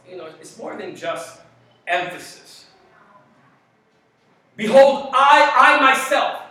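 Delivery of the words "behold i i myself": loud, high call of "Behold, I, I myself"; proclaimed Behold. Shown as a demonstration. Pastor proclaiming scripture to congregation, loud. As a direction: ring it out to stop the room.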